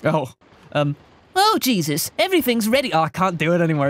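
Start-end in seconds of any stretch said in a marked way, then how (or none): 1.4-2.9 higher, a light go at the Battle Block Theater narrator; lightly voiced game narrator; Hattie Hatterson voice. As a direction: try to recall a cartoon narrator's voice, pitched up. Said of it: "do your battle, what, Battle Block Theater guy".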